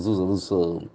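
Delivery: reserve said with a clear buzzing z, "ri-zerv"; Z sound. Shown as a demonstration. Teacher showing students the z sound in reserve. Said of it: "Okay, the Z sound".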